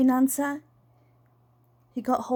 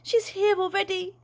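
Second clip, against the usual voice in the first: flinching dismay; 8 semitones higher than usual. A narrator's reading. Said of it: "Dad flinched".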